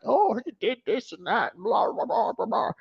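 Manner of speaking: high mocking singsong of critics' chatter; mock gossip voice. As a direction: sneer it out in a high prissy mock.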